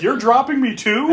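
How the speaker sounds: high, loud mock disbelief; incredulous dismay. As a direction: ask it as if betrayed, voice rising. Acted client dismayed at agent.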